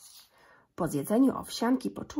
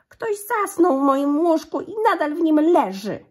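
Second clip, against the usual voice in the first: put-on much higher, much louder baby bear; cieniutki głosik; 9 semitones higher, 10 dB louder than usual. A narrator's reading. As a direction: shriek it high and loud like an alarmed little bear. (mały niedźwiadek krzyczy wysoko i głośno)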